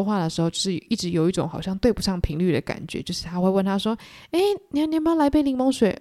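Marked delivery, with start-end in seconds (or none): 4.3-5.9 eager host offering. Retold or imitated. Gatsby eagerly offering drinks.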